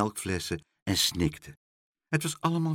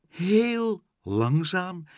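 drawn-out, suspenseful narration; suspenseful crawl, words pulled long; very slow; 6 semitones higher, much slower than usual. A narrator's reading. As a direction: stretch each word to match a slow descent. Narrator mimics the slow descent.

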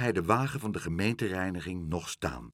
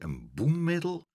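usual speaking voice

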